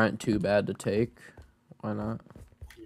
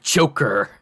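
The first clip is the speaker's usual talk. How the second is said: announcer voice; 6 dB louder than usual. Retold or imitated. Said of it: "the announcer says in Smash".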